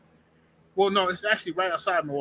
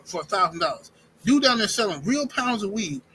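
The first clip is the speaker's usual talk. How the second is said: ordinary delivery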